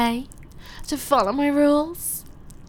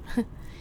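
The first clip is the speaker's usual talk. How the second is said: soft smirking laugh; 7 dB quieter than usual. Acted. Smirking at him.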